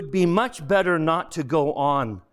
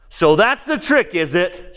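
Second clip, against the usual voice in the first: high, loud burst of bitterness; bitter shout; 6 semitones higher, 4 dB louder than usual. A narrator's reading. Ghost shouts at the one sent to him, bitter. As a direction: cry out as if catching a trick. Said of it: "shouted the ghost, outwardly bitter".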